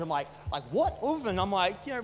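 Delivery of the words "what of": higher-pitched mock-baffled question; frustrated retelling. Preacher retelling a confusing text exchange, frustrated. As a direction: act out mild frustration at a cryptic reply. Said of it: "I'm getting frustrated at her".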